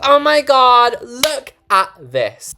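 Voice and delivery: high, loud delighted squeal, slowing on look; excited gush. Real, not acted. Gushing to viewers over the new counter, high and thrilled.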